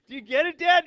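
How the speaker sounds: high, loud, eager check; excited child. Retold or imitated. Daughter checking dad got the joke, excited. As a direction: imitate a kid desperate for a reaction. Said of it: "she's so excited".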